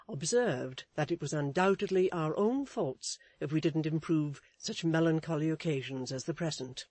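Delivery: ordinary delivery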